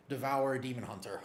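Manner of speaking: ordinary talk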